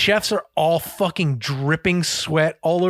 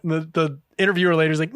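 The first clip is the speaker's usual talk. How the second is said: ordinary delivery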